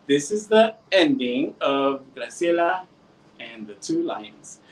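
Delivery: usual speaking voice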